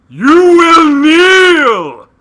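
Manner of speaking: very high, loud, slow shout; villain roar. Pretend. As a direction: roar it like a tyrant.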